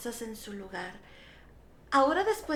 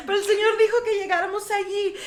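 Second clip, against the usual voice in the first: higher, louder, laughing as you speak; amused, raised-voice protest; laughing insistence; 6 semitones higher, 7 dB louder, faster than usual. Real, not acted. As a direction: insist through real amusement. Laughs while retelling her odd answer.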